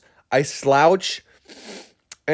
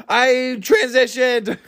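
happy Grumpy; 8 semitones higher, slower than usual. Retold or imitated. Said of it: "he goes".

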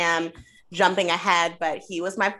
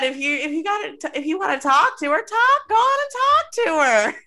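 high, rising mock of her plea; squeaky imitation building louder; climbing high and loud mocking her; mocking high quote; 10 semitones higher, 5 dB louder, faster than usual. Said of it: "she said".

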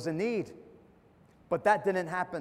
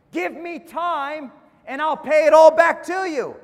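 loud, raised pleading cry; desperate plea; 6 semitones higher, 8 dB louder than usual. Acted. Servant begging his master for more time, loud pleading. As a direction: beg for time at full voice, higher.

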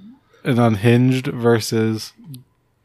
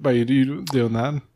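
normal talking voice

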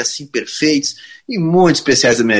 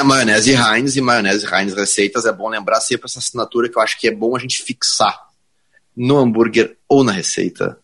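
ad read; 5 semitones lower than usual. Acted. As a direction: announce it like an ad. Sponsor read in lower voice.

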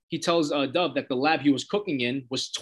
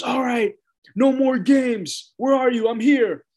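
lightly raised, slow call into the dark; Hank calling out; 9 semitones higher, 5 dB louder than usual. Retold or imitated. Hank calling across the pool, slow and raised. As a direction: call out slowly, a touch higher, searching.

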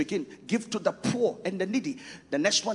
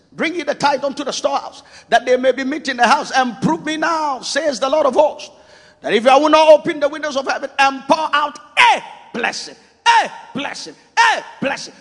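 high, loud, ringing scripture; preaching shout; 6 semitones higher, 10 dB louder than usual. Real, not acted. Preacher shouting the tithing promise at his congregation. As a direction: build and hammer a blessing, a blessing.

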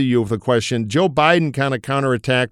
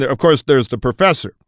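usual speaking voice

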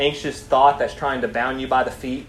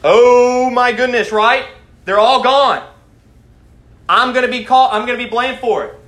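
high, loud cry of alarm; hammed-up panic, voice raised high; panicked guard; 9 semitones higher, 8 dB louder than usual. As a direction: act out a guard realizing he's in trouble. Voices the guard discovering open cells.

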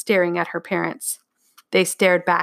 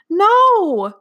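loud, high wail; sobbing protest; 12 semitones higher, 7 dB louder than usual. A narrator's reading. Girl refuses dad's order, sobbing loudly. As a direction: wail it at the top of your voice. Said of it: "Martha sobbed hard".

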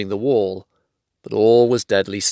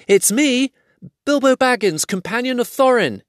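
eager cry; 11 semitones higher than usual. A narrator's reading. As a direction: call out in a rush to be found. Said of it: "he cried".

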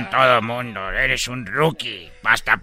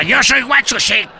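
loud self-announcement; 13 semitones higher, 7 dB louder than usual. Acted. Character announcing himself loudly.

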